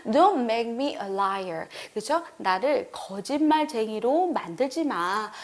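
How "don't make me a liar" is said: very slow model reading; slow demo. Shown as a demonstration. Teacher modelling the line slowly for students. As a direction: stretch each word out slowly. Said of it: "한번 해볼게요".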